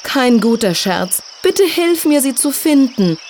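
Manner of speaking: high-pitched, beseeching fairy; pleading. A narrator's reading. Fari begs Silja for help finding them, pleading. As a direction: beg for help, high and urgent. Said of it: "sagte Fari ganz flehentlich".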